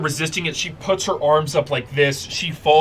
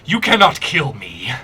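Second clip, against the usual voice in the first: god voice. Acted. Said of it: "She goes".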